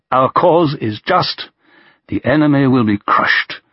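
slow, flat voice with a quaver; slow, steady, barely quavering. A narrator's reading. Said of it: "in a flat but quavering voice".

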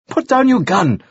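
high-pitched urgent command; urgent order. A narrator's reading. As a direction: order the gunman to back down. Bystander orders gunman to disarm.

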